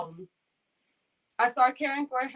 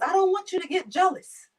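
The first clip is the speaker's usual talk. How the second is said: put-on higher, louder old man teasing; imitating him; 9 semitones higher, 6 dB louder than usual. Client teasing caregiver about her daughter, lightly voiced. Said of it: "he says".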